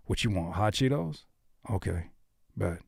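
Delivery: soft, offhand chat; low-key everyday back-and-forth; casual speaking voice. Shown as a demonstration. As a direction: check a buddy's snack order quietly. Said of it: "We talk like that all day long".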